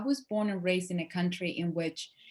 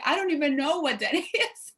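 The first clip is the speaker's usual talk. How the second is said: laughing; 8 semitones higher, 6 dB louder than usual. Retold or imitated. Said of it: "I was like".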